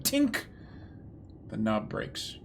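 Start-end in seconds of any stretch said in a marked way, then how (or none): 0.0-0.4 high-pitched "tink"; little high ping of metal snapping; tink sound effect. Acted. Game master voices a door knob breaking, high tink.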